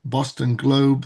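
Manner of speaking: normal talking voice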